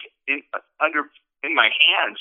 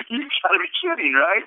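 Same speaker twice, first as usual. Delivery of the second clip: higher and fast, delighted disbelief; amazed disbelief; 12 semitones higher than usual. Retold or imitated. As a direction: blurt it out like you can't believe the news. Hearing Alan is released tomorrow.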